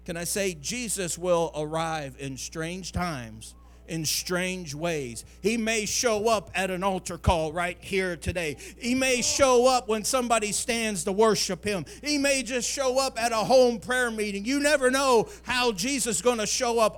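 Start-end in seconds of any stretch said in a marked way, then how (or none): 5.4-17.0 loud, rising sermon climax, half sung; impassioned preacher voice; full-force preaching. Acted. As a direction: preach it out to the whole church, building.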